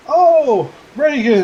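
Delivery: high, loud joyful cry of welcome; delighted uncle. Acted. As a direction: greet the long-lost nephew with a burst of joy. Uncle greets his nephew joyfully.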